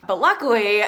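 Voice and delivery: ordinary talk, nothing marked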